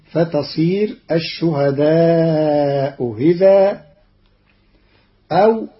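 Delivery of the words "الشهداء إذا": hamza of إذا softened, gliding between hamza and y; slow الشهداء إذا, no full catch before إذا; eased second hamza. Shown as a demonstration. Teacher shows Qalun's reading, إذا with eased hamza. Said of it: "بتسهيل الهمزة الثانية بين بين".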